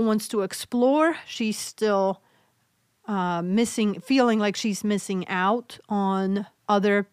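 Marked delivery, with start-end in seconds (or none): none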